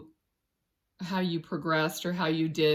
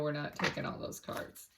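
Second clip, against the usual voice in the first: voiceless, much softer remark to herself; whispered aside; 4 semitones lower, 8 dB quieter than usual. Real, not acted. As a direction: whisper it as a private correction. Quiet aside about extra cards.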